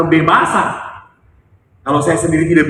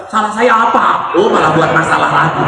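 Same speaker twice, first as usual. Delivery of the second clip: mock indignant father; 4 semitones higher, 6 dB louder than usual.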